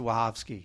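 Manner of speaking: WACHOWSKI said "va-HOV-skee"; Polish pronunciation. Shown as a demonstration. Co-host objecting to the Polish version, Wachowski as va-HOV-skee. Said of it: "they don't pronounce it".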